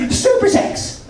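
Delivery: very high breathy squeak, whispered; squeaky old lady. Retold or imitated. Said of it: "she goes".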